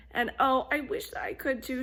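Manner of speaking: a hint of a chatty goose; lightly voiced goose.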